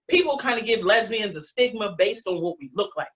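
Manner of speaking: ordinary talk, nothing marked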